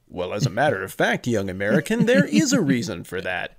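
hammed-up children's-show professor. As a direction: parody a scripted professor enlightening a youngster. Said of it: "that same script with professor voice".

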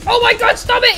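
high, loud plea to stop; shouted protest. Acted. Attacked figure to attacker, high shouted protest. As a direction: yell as if under attack.

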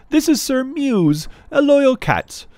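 high, loud, grand announcing; formal little girl. Acted. Jane introducing Leo to her court, grandly. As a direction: introduce him like a herald.

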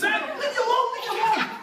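high, laughing plea; whiny goblin. Acted. Goblin flees Herschel, high whiny voice with laughter. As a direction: cry it out while fleeing, laughing.

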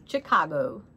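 accented "Chicago", spoken out in full voice; heavy accent on Chicago. Real, not acted. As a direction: say "Chicago" in her own accented way. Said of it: "say that with a heavy accent".

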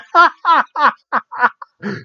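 high staccato mimicked cackle; imitated laughing fit. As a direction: burst into a commentator's helpless laugh. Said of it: "And Mike goes".

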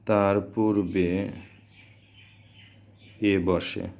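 slow, with long gaps between phrases; slow dictation. Shown as a demonstration. Teacher giving students a rule to write, slow dictation. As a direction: dictate it piece by piece for note-taking.

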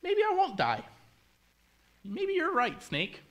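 very high, wondering Eve voice; persuaded Eve. Acted. Eve being won over by the snake, high wondering voice. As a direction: squeak it out high as the idea lands. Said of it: "she goes".

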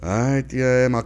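ordinary talk, nothing marked

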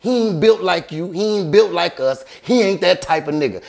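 high, forceful retold put-down; heated taunting. Convincing his friend the guard won't fight, heated taunting.

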